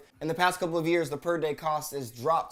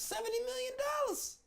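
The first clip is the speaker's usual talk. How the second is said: very high, soft, amazed; awed falsetto over the bail figure; stunned squeak; 10 dB quieter than usual. Real, not acted. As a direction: squeak out the number in shock. Stunned repeat of the sum.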